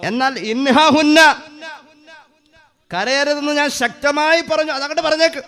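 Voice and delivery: the Prophet insisting firmly; firm command. Acted. Prophet gives firm order.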